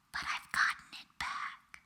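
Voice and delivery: breathy whispered confession, barely voiced. Shown as a demonstration. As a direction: whisper it like a secret you reclaimed.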